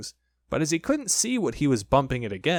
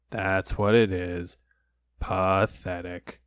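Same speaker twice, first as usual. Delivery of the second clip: low, slow, doleful donkey voice; gloomy, ponderous old donkey; Eeyore; 5 semitones lower, slower than usual. A narrator's reading. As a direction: sink low and drag each word. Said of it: "Eeyore, the old gray donkey".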